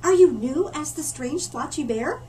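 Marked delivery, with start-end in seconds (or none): 0.0-0.7 higher, louder, eager little panda; a bright, curious cub asking; friendly panda voice. Acted. Panda cub greeting the newcomer, eager and high.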